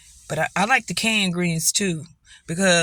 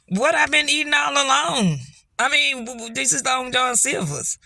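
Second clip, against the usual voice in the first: higher, outraged disbelief; retold disbelief; 7 semitones higher than usual. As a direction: act stunned at the counter. Disbelief at the cod being out.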